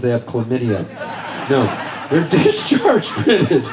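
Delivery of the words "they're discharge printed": cracking up on "discharge printed"; laughing correction. Real, not acted. Correcting their own dirty joke to the crowd, laughing.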